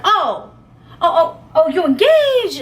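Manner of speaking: high, laughing mock surprise; surprised guy. Guy hearing the girl is engaged, startled and laughing, up high.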